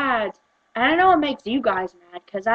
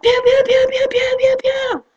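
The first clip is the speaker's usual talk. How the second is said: high, loud, rapid-fire pew noises; mock laser shots; 14 semitones higher, 7 dB louder than usual. Pretend shooting at people who mispronounce, high pew noises. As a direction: imitate a toy blaster in a high squeal.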